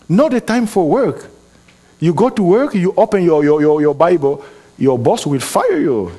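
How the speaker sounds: a touch of full preaching force; preaching emphatically. Acted. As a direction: hammer the point home to the congregation. Emphatic sermon point.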